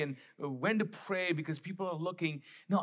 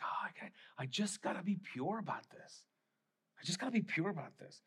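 whispered prayer; 7 dB quieter than usual. Acted. Jesus voiced praying alone privately.

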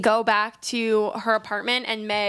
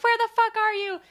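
high, frantic friend; mock panic; 11 semitones higher than usual. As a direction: demand it in mock worry.

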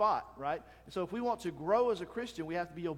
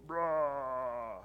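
flexing grunt; 4 semitones lower than usual. Retold or imitated. Bodybuilder grunt again.

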